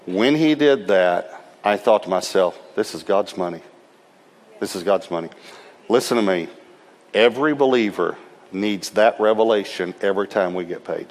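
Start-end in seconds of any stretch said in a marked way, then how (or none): 2.8-5.3 softer, reverent repetition; hushed awe. Retold or imitated. Preacher recalling his reverent thought about the check. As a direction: let the realization sink in quietly.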